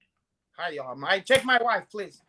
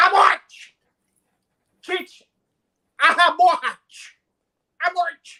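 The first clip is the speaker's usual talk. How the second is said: shouted much higher and louder, repeated order; mock shout; 11 semitones higher, 9 dB louder than usual. Answering a pregnant girl like a mob boss, mock shout. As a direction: bellow abort like a mob boss. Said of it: "that's what I would say".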